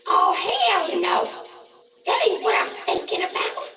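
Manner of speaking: very high, loud mock screech; mimicked housemate's outburst. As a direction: holler it back in a falsetto, stretched out. Mimics housemate's shrieked retort.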